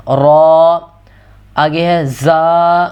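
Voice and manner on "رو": رو full and heavy with rounded lips; رو thick and rounded. Shown as a demonstration. Teacher showing heavy ر to students. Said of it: "اسے ہم نے موٹا کر دینا".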